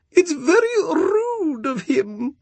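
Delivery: high, slow, sulky Moon complaining; peeved Moon voice. A narrator's reading. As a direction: pout it out like an offended Moon. The Moon's complaining voice.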